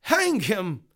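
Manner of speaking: loud, high call for execution; demanding cry. Acted. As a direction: demand it like a hot-headed juror. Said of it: "Mister Heady demanded".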